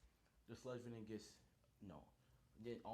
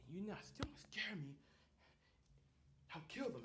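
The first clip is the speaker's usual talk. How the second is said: villain threat; 9 semitones higher than usual. Acted.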